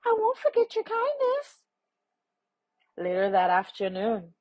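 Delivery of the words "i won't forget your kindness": very high, quick bee voice; tiny voice. A narrator's reading. Bee thanking the man as it flies away, tiny high quick voice. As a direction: squeak it quickly like a tiny insect. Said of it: "A tiny voice said".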